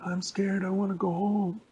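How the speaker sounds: put-on high little boy's whimpering voice; scared little boy. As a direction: plead to go home. Little boy frightened in tube.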